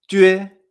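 yue as Chinese J plus Ü-E, "jüe"; loud, clear "jüe"; J con diéresis. Shown as a demonstration. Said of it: "Fíjate, con la J". (jüe con U francesa)

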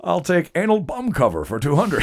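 high, swooping mock contestant voice; hammed-up Connery on a quiz show; Sean Connery. Game-show contestant picking a misread category, hammed up. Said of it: "best Sean Connery impersonation".

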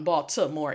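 Baltimore with a clear T in the middle; spelled-out T. Shown as a demonstration. Teacher owning a foreign tongue, Baltimore with a sounded T. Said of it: "Me and my foreign tongue".

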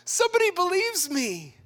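very high, squealed delight; giddy falsetto-high gushing; overjoyed relief. Acted. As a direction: play a hammed-up thrill at finally being believed. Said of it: "the feeling for Mary of".

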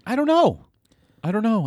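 squeaky falsetto; high squeaky voice. Pretend.

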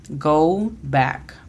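"go back" with gou and short a in back; English go back. Shown as a demonstration. Teacher models "go back" for students, English pronunciation. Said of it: "La pronunciación fonética de la primera es G O U".

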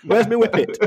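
broad Yorkshire voice, played for laughs; comic northern Yorkshire accent. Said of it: "broad Yorkshire accent".

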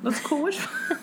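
repeating it while laughing. Retold or imitated. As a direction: crack up mid-line.

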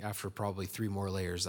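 usual speaking voice

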